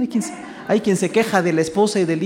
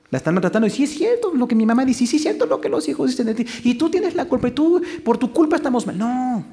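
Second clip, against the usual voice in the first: blaming husband; 8 semitones higher than usual. Retold or imitated. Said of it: "el hombre también se suma".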